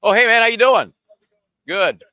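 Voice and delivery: high, loud friendly hail; sudden loud greeting; calling out. Real, not acted. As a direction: call out to someone passing in the background. Greets passer-by in the background.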